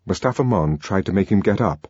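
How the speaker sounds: plain reading voice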